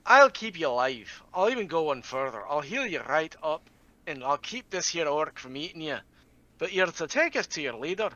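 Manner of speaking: a hint of a Scottish brogue, calm and persuasive. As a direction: play the healer bargaining in a mild Scottish burr. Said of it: "in my best Scottish brogue".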